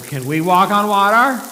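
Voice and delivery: high, loud eager plea; eager question. Acted. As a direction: beg to join in excitedly. Friends begging to try.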